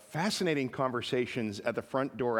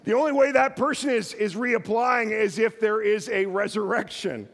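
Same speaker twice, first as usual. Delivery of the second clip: higher, louder, amused emphasis; a lively, amused punchline; excited punchline; 7 semitones higher, 5 dB louder than usual. Real, not acted. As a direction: land the joke with a grin. Punchline after funny letter.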